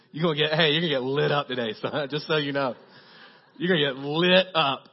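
mock warning. Mock warning to teased son.

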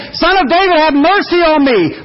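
high, pleading yell; screaming for mercy. Retold or imitated. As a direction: cry out over the crowd. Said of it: "Louder than Timothy could scream".

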